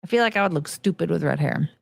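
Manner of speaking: ordinary talk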